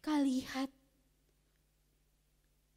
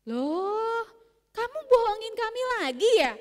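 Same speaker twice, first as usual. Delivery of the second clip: indignant villagers; 8 semitones higher, 8 dB louder than usual. Acted. Villagers scold lying boy.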